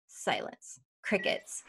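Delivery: barely voiced, as if into an awkward silence; hushed deadpan. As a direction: drop to a hush for the dead air. Mimics dead silence after her question.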